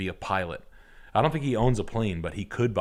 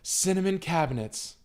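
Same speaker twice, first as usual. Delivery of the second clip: lightly voiced proud wife; 8 semitones higher than usual. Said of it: "she's like".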